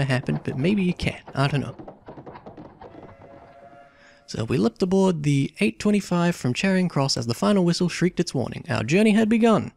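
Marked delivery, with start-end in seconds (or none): 4.3-9.7 reading the game text aloud as a story; story narration. A narrator's reading. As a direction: narrate it like an adventure novel. Reads the game's story text.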